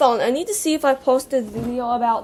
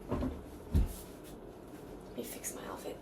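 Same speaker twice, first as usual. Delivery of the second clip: ordinary talk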